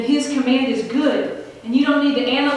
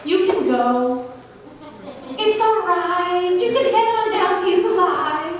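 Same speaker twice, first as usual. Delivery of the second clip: a lighter, higher, gentle send-off; nice and polite; 5 semitones higher than usual. Shown as a demonstration. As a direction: sweetly reassure him he can go. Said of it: "says something very nice and polite".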